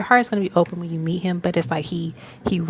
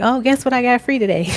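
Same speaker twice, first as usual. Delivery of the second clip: higher, louder eager announcement; excited quote; 6 semitones higher, 8 dB louder than usual. Retold or imitated. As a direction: share a lucky find with a friend. Excitedly tells friend about freebie.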